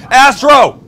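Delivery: loud, high call of a dog's name; sharp shout at the dog. Real, not acted. Cook yells at his dog Astro to quit it, loud sharp call. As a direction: bark the name out to stop him. Yelling at the dog mid-video.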